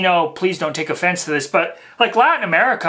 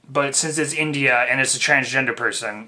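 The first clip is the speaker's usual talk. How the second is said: usual speaking voice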